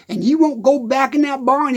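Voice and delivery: normal talking voice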